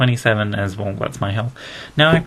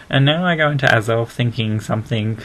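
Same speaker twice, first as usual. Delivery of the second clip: ordinary talk, nothing marked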